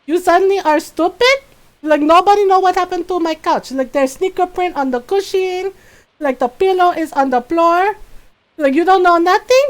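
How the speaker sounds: high, loud mock tirade; sarcastic mom scolding her sons; mom going off. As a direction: mom ranting about the couch. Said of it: "It's like".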